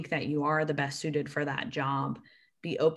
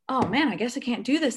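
feigned disappointment; 7 semitones higher than usual. Pretend. Pretending disappointment, playing along.

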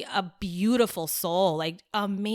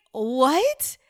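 high, louder retold shock, drawn out slowly; astonished disbelief; 9 semitones higher, 6 dB louder, much slower than usual. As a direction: act out utter disbelief, stretching the words. Acts out astonishment at news.

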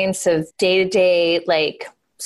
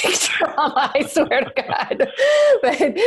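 laughing; 8 semitones higher than usual. Real, not acted. Laughing through talk of trauma.

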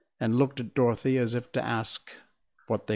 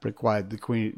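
plain reading voice

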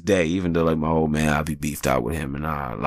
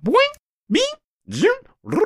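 high silly boing and zoom sounds; video game noises; 4 dB louder than usual. Retold or imitated. Imitating video game noise heard from upstairs. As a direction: make the game bleeps. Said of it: "I heard video game noise".

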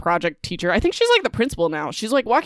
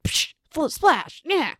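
mock splash noise; 9 semitones higher, slower than usual. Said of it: "in all movies, they're like".

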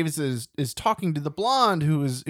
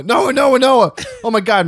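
high, loud mock-frantic calls; urgent calling out; 10 semitones higher, 11 dB louder than usual. Trying to get Noah out, mock urgent shouting. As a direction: call your friend in hammed-up alarm.

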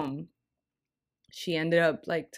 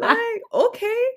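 giddy okay; 8 dB louder, slower than usual. Real, not acted. Giddy reaction, slow.

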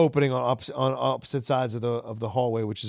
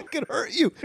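mock threat; 11 semitones higher, 3 dB quieter than usual. Host mimics line high.

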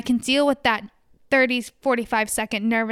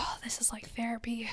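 soft whispered aside; whispering; 13 dB quieter than usual. Real, not acted. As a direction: whisper it under your breath. Joking aside about therapy.

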